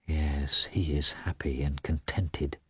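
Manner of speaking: low, hushed musing; inner thought. A narrator's reading. Wife thinks bitterly of her contented husband, hushed. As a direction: thought half to herself, soft and low.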